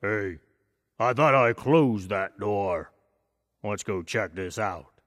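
slow, lightly voiced papa bear; a big bear, puzzled, taking his time; gentle hint of a father bear. A narrator's reading. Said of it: "the papa bear looked at that and said".

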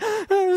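sharp, very high gasp; little bear's squeaky gasp; high shocked gasp. A narrator's reading. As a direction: gasp at the broken chair. Baby bear finds broken chair.

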